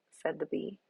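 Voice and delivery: plain reading voice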